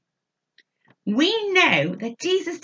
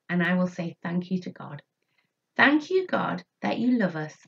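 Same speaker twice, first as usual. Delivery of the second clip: prayer; 5 semitones lower than usual. Acted. Teacher prays with children.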